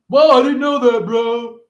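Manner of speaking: high, loud fake amazement; mock bro surprise. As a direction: play the dumbstruck bro. Voicing the anarchist's surprise.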